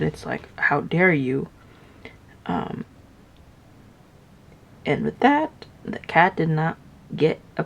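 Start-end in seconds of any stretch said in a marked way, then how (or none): none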